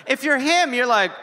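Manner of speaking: usual speaking voice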